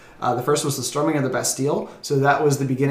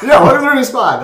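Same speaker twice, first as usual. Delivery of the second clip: laughing welcome; 11 semitones higher, 10 dB louder, faster than usual. Real, not acted.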